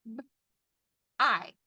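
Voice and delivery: short B, pause, long I; sounded out. Shown as a demonstration. Teacher tapping the first syllable, sounded out. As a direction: break b-I into single sounds. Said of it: "first syllable".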